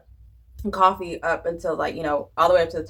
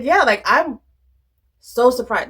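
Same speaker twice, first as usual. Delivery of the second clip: higher and louder, amazed; gushing at her own discovery; excited retelling; 6 semitones higher, 5 dB louder than usual. Retold or imitated. Retelling her surprised reply to mom.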